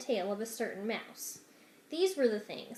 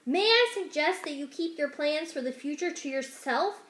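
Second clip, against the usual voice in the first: higher and louder, building exasperation; exasperated rat; 8 semitones higher, 7 dB louder than usual. Acted. Rat lecturing servant girl about whispering, rising exasperation. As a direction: scold her ever more shrilly polite.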